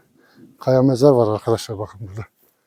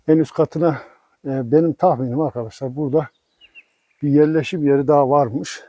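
ordinary talk, nothing marked

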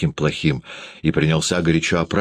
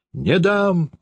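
high-pitched refusal; acted outcry, little added volume; father shouting; 12 semitones higher than usual. A narrator's reading. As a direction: refuse in a sudden high cry. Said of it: "закричал отец".

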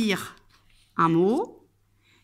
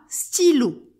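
"stylo" higher, clearly set apart; word for repeating; 5 semitones higher, 4 dB louder than usual. Shown as a demonstration. Teacher asking pupil to repeat along, "stylo" clearly modelled. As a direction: model "stylo" on a raised pitch. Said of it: "Répète avec moi".

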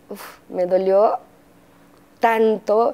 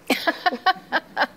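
risa pícara; 10 semitones higher than usual. Real, not acted. Risa tras la travesura.

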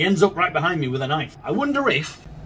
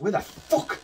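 usual speaking voice

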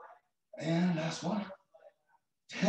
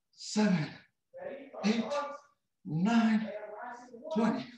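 counting reps; 6 semitones higher, much slower than usual. Acted.

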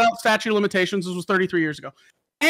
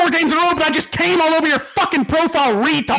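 raised, taunting trash talk; hammed-up gloating insult to a teammate; mock angry message; 4 semitones higher than usual. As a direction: fire it off like a spiteful victory message. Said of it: "And then you message him, he's like".

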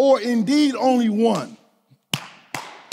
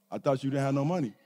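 much lower and softer, a lightly voiced kid; low, quiet gotcha from the little boy; kid catching dad out; 10 semitones lower, 10 dB quieter, faster than usual. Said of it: "and Zay go".

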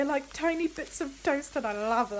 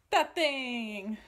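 ordinary talk

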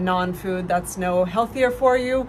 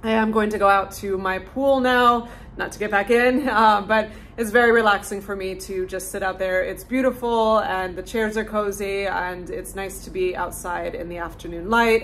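ordinary delivery

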